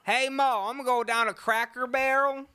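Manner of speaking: high, loud Southern holler; Southern accent. Acted. Host calls out to Ma, high loud Southern accent. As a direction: holler it in hammy Southern twang. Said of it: "Can you do a Southern accent?".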